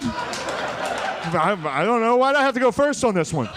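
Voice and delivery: high-pitched comic whining defence; flustered protest. Acted. Congressman defending himself for going first, high flustered protest.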